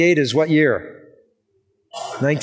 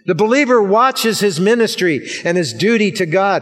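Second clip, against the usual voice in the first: ordinary delivery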